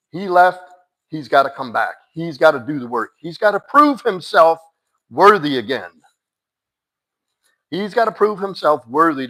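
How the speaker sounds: firm insistence. Real, not acted. Telling Mads he must earn his way back, loud and firm. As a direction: drive each demand home hard. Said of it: "laying down the rules".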